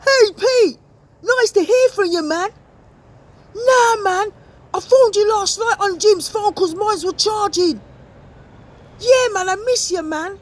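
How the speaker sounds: casual mate shouting down the phone; lad on phone. Acted. Young man shouting to his mate Pete on a bad phone line.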